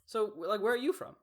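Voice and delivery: lightly voiced eager classmate asking; friendly classmate. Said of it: "and he's just like".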